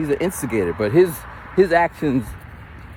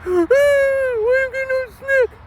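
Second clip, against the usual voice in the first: very high, drawn-out mock wail; squealing, exaggerated kiddie whine; whining kids; much slower than usual. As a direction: imitate children crying in protest. Mimics whining kids denied snack.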